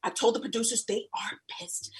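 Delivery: hushed secretive whisper; whispered warning. Retold or imitated.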